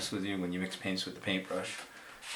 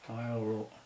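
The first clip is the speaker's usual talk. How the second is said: mouth full; 3 semitones higher than usual. Real, not acted. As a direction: brush held between teeth. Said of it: "talking with my mouth full of paintbrush".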